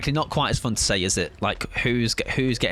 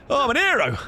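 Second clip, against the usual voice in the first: high, louder hammed-up boast; much higher, proud exclamation; mock triumphant; 4 dB louder, faster than usual. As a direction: crow about the rescue. Proud after saving teammate.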